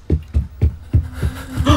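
sudden frightened gasp; sharp gasp. Real, not acted. Monster appears, sudden gasp.

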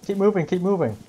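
shouting orders. Real, not acted. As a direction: yell it to push the squad on. Squad under fire, shouting orders.

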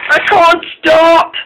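high, drawn-out cry of alarm; panicked shout. A narrator's reading. Runaway Caitlin shouts to Molly, panicked. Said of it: "shouted Caitlin".

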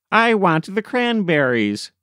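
put-on high, childish excited demand; eager cry. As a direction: whine it like a greedy kid. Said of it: "cried Smith".

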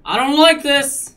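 loud frightened cry, slow; scared yell. Real, not acted. Scared gamer in horror game.

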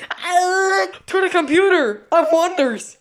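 high, loud mock-heroic cry; grand rallying shout; triumphant announcement. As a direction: proclaim it like a cartoon hero. Announces his great idea.

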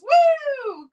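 high, loud whoop; whooping the chat's woo. Pretend. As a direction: let out a big cheer. Reading chat's cheer.

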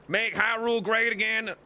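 put-on country accent, short of a yell. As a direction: state it like a country-accented voter. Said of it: "in this accent".

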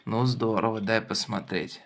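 usual speaking voice

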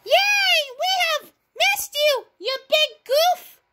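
high, drawn-out glad greeting; happy reunion cheer. Acted. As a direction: cheer a long-missed friend with delight. Friend greets Terrence after absence.

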